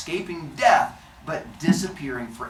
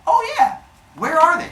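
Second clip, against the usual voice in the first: high, louder, bright eager response; 7 dB louder than usual. Acted. Cronus answers Mother Earth, high and eager. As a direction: jump at the reminder. Cronus reminded about his brothers.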